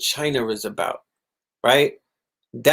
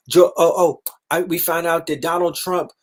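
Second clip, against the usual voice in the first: ordinary delivery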